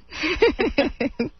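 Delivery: light high giggle; a short amused giggle. Real, not acted. Laughs at her own joke.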